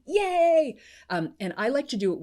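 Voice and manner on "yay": loud, high yay; celebratory cheer. Shown as a demonstration. Coach demonstrates her celebration phrase, celebratory cheer. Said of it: "you've heard a few of mine".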